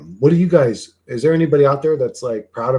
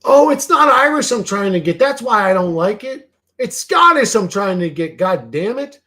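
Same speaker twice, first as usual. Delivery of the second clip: high, loud burst of realization; sudden realization; 8 semitones higher, 7 dB louder than usual. Real, not acted. Streamer realizing he wanted Scottish music, not Irish, surprised. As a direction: it finally clicks, blurt it out.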